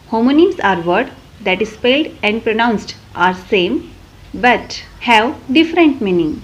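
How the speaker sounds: reading a definition out slowly for notes; very slow, deliberate classroom definition; slow dictation. Acted. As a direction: spell it out word by word like dictation to a class. Teacher dictates English definition.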